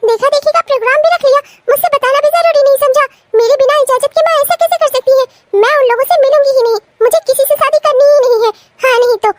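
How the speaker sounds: high, fast, indignant protest; outraged shock. Acted. Daughter learns mother fixed her match, shocked and outraged. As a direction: rattle out your outrage, pitched high.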